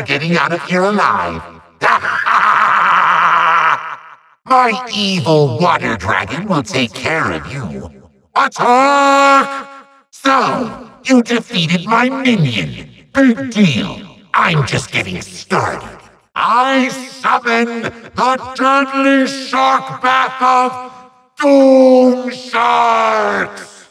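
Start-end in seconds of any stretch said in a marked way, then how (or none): 1.8-3.9 long rolling villain cackle; drawn-out gloating evil laugh. Acted. Villain gloats after threat. 16.3-23.9 high, slow, drawn-out incantation; grand summoning. Acted. Villain casts a summoning spell.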